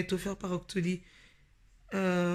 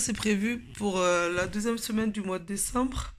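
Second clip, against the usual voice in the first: normal talking voice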